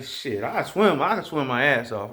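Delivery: normal talking voice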